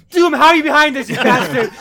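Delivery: high, very loud hammed-up outrage; mock furious accusation. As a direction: yell it like a paranoid hero. Reed accuses Doom, acted out.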